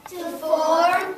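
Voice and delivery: whole class shouts "To form" back; loud choral repeat; class echo. Real, not acted. Students echoing the teacher, loud. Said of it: "Repeat after me".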